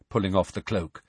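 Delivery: usual speaking voice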